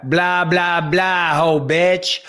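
slow, drawn-out Nick Diaz voice; lazy Stockton trash-talk voice; Nick Diaz impression. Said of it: "I thought you were doing Nick Diaz".

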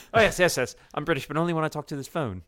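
a posh British voice put on for the phone; mock British accent. Joking host imitates a man turning British for his phone, mock British accent. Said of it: "I'm just trying to picture".

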